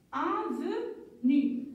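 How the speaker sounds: "avenue" drawn out slowly, syllables held; slow reading. Shown as a demonstration. Teacher rereads "avenue" slowly with the class. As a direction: read "avenue" slowly for pupils. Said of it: "on va les lire encore une fois".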